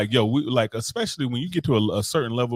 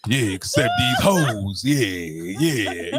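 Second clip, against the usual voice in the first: tough guy impression; 2 semitones lower, slower than usual. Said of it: "if I'm a weaker man, I'm up here like".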